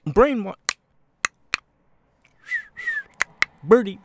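very high, loud sudden yelps; blurted tic. Acted. Toby's tic explodes out, very high and loud. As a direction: yelp the words out as if they escaped. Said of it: "I have Tourette's".

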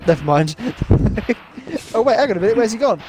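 ordinary talk

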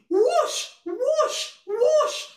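high swooping whooshes; wind whooshing. Acted. Storyteller imitating the wind, high rising whooshes.

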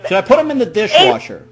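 barely holding the laugh in. Acted. Prank caller asking about the dishwasher, laughing.

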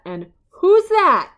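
loud delighted who's that; excited question. Real, not acted. Excited reveal to child listener.

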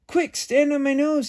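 high, loud urgent call; urgent coyote. A narrator's reading. The coyote urges the cookie onto his nose, high urgent call. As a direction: hurry him up. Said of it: "said the coyote".

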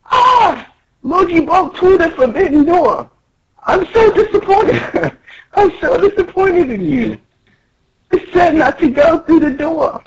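very high, shrill-pitched scolding. Acted.

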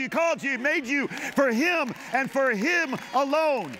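rising, fervent preacher's build; preaching at full force. Real, not acted. Pastor rousing the congregation, full-force preaching.

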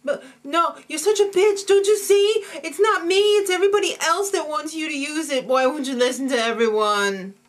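high, loud mock tantrum; shrieky overacted meltdown, easing at the end; [name]. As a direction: rant in hammed-up tearful outrage. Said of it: "that Jesse's crazy".

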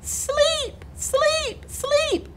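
very high, tiny sleepy voice; tired babies' whisper. A narrator's reading. Tired babies to the cat, small sleepy pleading. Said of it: "the tired babies finally whispered".

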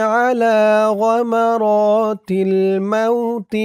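slow, melodic Arabic chant; Quranic-style recitation. Acted. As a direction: recite the dua like tilawat, unhurried.

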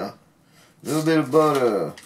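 playful put-on voice. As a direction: tease the viewer with a cartoonish tone. Playful repeat about butter.

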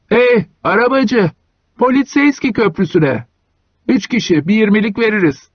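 a hint of a high, trembling hunchback voice; trembly call. A narrator's reading. Hunchback hailing Iona, a touch of a trembling high call. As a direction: call out to the driver, lightly quavering. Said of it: "titrek bir sesle seslendi".

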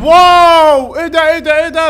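loud thrilled wow; loud amazed gamer wow; excited wow. Real, not acted. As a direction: blurt out amazement. Reacting to new superpower.

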